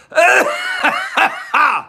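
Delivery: very high squealing laugh; a nervous giggle that shoots way up. Real, not acted. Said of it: "it's a nervous laugh".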